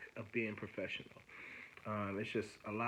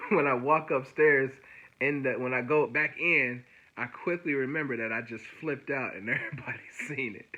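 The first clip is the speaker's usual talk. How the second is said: louder, with laughter breaking in; amused, laughing; 3 semitones higher, 7 dB louder than usual. Real, not acted. Telling viewers how he flipped out, laughing at himself. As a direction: tell it through real amusement. Laughs recalling his outburst.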